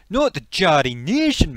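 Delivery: loud stress on "Geordie Nation"; emphatic correction. Acted. Geordie correcting a misheard "Geordie Nation", emphatic. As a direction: correct them, hitting "Geordie Nation" hard.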